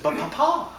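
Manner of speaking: high-pitched hammed-up French accent; fake French accent. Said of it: "kill the fake French accent".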